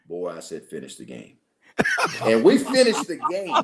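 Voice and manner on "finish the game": very low, hushed command, imitating an old coach; deep-voiced coach. Old coach insisting to a young player, deep-voiced. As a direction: drop deep and quiet, brooking no argument. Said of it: "says in that deep voice".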